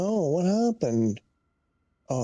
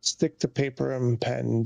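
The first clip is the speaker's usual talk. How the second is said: normal talking voice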